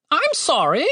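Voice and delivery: high-pitched polite bird voice; talking bird. A narrator's reading. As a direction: apologize in a high little bird voice. Talking bird's first words.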